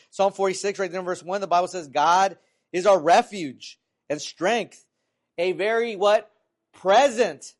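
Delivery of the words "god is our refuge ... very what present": loud, emphatic, slow scripture proclamation; scripture reading. Acted. Preacher reads the psalm to congregation, loud scripture reading. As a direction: proclaim each phrase forcefully, pausing for effect.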